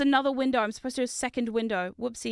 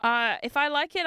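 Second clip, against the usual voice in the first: normal talking voice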